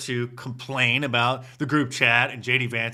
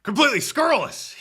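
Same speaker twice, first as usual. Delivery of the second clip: mocking imitation; 9 semitones higher, faster than usual. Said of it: "using language that's uncommon to us peons".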